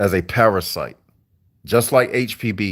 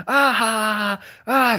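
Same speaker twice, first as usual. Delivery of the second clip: high hammed-up cackle; mocking how coworkers laugh out loud; 13 semitones higher, slower than usual. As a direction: mimic a loud carefree laugh. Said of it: "They can laugh loud".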